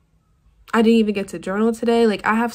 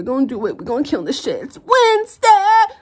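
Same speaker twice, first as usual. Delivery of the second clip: hyped-up cheer; 6 semitones higher, 8 dB louder than usual. Real, not acted. Psyching herself up to get going.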